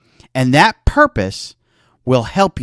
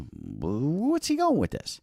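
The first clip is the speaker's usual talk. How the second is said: puzzled listener; 12 dB quieter, slower than usual. Retold or imitated. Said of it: "I know it's like kind of".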